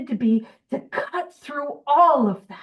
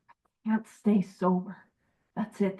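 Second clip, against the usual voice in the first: ordinary talk, nothing marked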